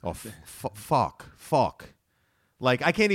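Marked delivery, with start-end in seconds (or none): 0.0-1.3 Boston fork as fuck, R dropped; Boston accent. Shown as a demonstration. Boston woman demonstrating dropped R for her co-host. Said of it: "We say fuck". 1.4-1.9 Fuck with the R dropped, Boston style, a bit louder; Boston accent. Shown as a demonstration. Boston woman demonstrating her lost accent to her co-host. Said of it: "We say fuck".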